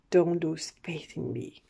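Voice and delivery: breathy whispered card message. Acted. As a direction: breathe the words as a secret plea. Reading card message softly aloud.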